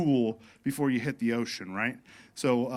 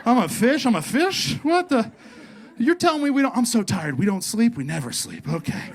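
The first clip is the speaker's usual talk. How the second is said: much higher, louder, laughing fish dude; stoned fish voice; 7 semitones higher, 6 dB louder than usual. Pretend. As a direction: play a baked fish freaking out. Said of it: "They're probably like".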